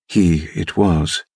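ordinary delivery

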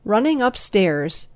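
plain reading voice

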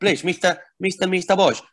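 a hammed-up Spanish waiter accent, pleading; pleading in a comic Spanish accent to the boss; Spanish barman caricature. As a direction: play the Manuel-style Spanish barman begging. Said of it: "um, caricature".

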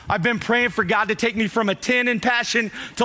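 normal talking voice

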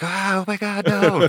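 high-pitched, hammed-up shriek; mock panicked scream. Soldier mocking his own fright at a snake, high mock scream. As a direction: squeal it like a terrified wimp, for laughs. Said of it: "scream like a little bitch".

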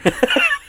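squeaky burst of laughter; a high, surprised giggle. Real, not acted. Laughing at his own joke.

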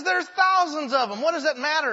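put-on passer-by scoffing at the boy; scoffing adult. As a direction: scoff at the boy's effort. Skeptical adult on the beach.

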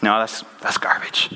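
low, half-whispered dismissal; muttered self-rejection. Acted. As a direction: mutter it to yourself, scrapping the draft. Son rejects his own letter draft.

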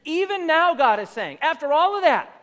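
loud, rising preacher's build on each after all; full-force preaching. Acted. Preacher rallying the church, loud building cadence. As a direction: hammer the repetitions home.

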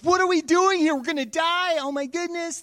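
high, loud panic; panicked crowd. Acted. Frightened Israelites at the edge of Canaan, panicking. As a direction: shriek it like the crowd is doomed. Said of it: "They're like".

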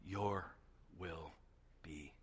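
very soft, slow, word by word; each word falls away to nothing; a hushed, searching final plea; sincere whispered question. Acted. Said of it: "ask it with full sincerity".

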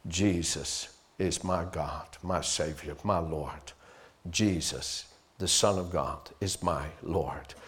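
low, soft, slow declaration of faith; hushed creed spoken from the heart; quiet confession. Shown as a demonstration. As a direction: speak it softly like a personal vow. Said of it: "by saying".

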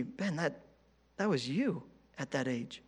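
soft, hushed divine rebuke; God's gentle voice. Retold or imitated. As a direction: say it softly, like God speaking to the heart. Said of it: "God was saying to me".

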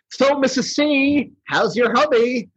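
high, slow, cheeky snowman; lightly high Snow Miser. Retold or imitated. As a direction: tease her sweetly. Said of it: "Snow Miser's talking".

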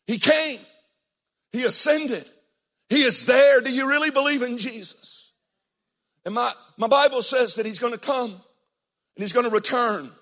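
loud, high preaching climax; building sermon crescendo; fiery preaching. Acted. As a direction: preach it with rising fire. Preacher builds to full force.

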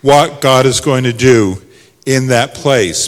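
loud, high, driving exhortation; rally the congregation at full voice; fervent pulpit shout, easing toward the end; impassioned preaching. Real, not acted.

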